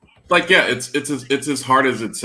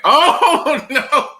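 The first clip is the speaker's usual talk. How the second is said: surprised; 9 dB louder than usual. Retold or imitated. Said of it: "you're like".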